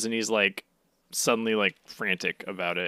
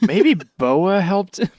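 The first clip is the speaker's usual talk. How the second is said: mock dim realization; 10 semitones higher, 9 dB louder, slower than usual. Mocking slow-witted Marines.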